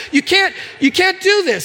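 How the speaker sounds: high, pleading mock panic; worried parent's protest, acted out; retold panic. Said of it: "I start to panic".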